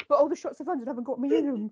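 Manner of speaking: high, fast mock London accent; squeaky send-up of a British accent; Oscar Isaac's British accent. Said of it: "That's the, that's the accent".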